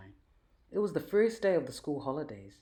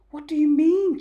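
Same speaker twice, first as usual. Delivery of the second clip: high, loud amazed question; wide-eyed; 15 dB louder than usual. A narrator's reading. Jack asking Dad about the libraries, wide-eyed and loud. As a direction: ask it with wide eyes, amazed. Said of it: "Jack asked wide-eyed".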